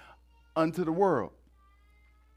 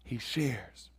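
usual speaking voice

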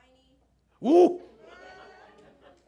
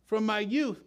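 slow, higher, self-assured; preacher voicing the rich young man proudly; proud claim; 6 semitones higher, 6 dB louder, slower than usual. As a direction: say it like you've kept every rule. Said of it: "What do you hear in his voice?".

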